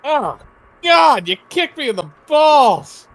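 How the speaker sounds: high, loud, drawn-out wail; hammed-up cry of pain, slow and high; pained mock outrage. Player kicked in the balls, mock-agonized yell. Player cries out at imagined kick.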